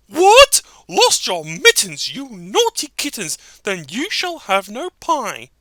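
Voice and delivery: sharp loud scolding mother; scolding mother cat. Acted. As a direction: shout at the naughty kittens.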